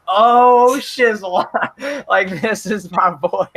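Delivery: high, loud, drawn-out "oh"; thrilled outburst. Retold or imitated. Reliving hearing the Godzilla theme, thrilled outburst. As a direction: relive a fan's awestruck outburst. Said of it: "and I was like".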